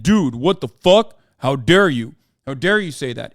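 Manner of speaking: mock outrage. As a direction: play the offended critics, half-mocking. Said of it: "people yelled at me and said".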